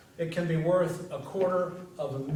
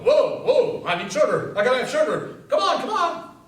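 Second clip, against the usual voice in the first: loud, pleading craving that climbs higher; craving head voice; 6 semitones higher, 6 dB louder than usual. Pretend. The head fusses for sugar, loud and pleading. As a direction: whine like an addict begging for a fix. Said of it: "your head will go".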